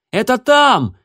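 high loud cry of discovery; excited exclamation. A narrator's reading. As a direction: cry out, pointing. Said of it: "воскликнула она".

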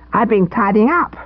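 put-on high boy voice, a touch piqued; touch of pique. A narrator's reading. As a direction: sound mildly offended at being doubted. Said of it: "with a touch of pique".

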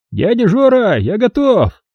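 high, happy call to uncle; eager report. Acted. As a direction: call out gladly. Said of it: "известил радостный Сеня".

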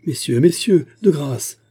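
high, loud begging appeal; insistent plea. A narrator's reading. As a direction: implore them to listen. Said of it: "insista Biscara".

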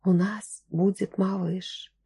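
soft, slow murmur; very quiet murmur. A narrator's reading. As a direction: murmur it very softly and slowly. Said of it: "тихо-тихо пробормотала".

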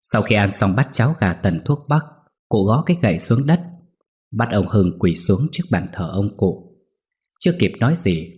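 ordinary delivery, nothing marked

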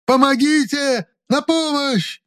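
very high, drawn-out scream; long, high, desperate shout; full-throated cry for help. A narrator's reading. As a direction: yell for rescue at the top of your range. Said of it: "во всё горло закричал оловеец".

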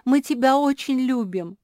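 high, loud heartfelt cry; all in chorus. A narrator's reading. Family tells Куки of their love, in loud chorus. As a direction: declare love as a whole group. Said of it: "хором подхватили все".

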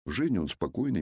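usual speaking voice

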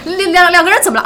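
stammering alarm. Retold or imitated. Host retelling her worried question about the sneeze, flustered. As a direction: stumble over the words in mock panic. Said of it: "赶紧就问".

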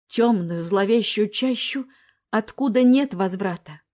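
plain reading voice

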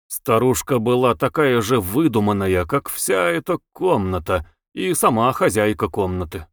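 usual speaking voice

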